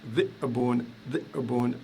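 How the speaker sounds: dhi'bun with a catch in the throat after dhi; dhi'bun split by a brief glottal gap; glottal stop demo. Shown as a demonstration. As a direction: stop the air after dhi in dhi'bun, then release bun. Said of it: "je fais un coup de glotte".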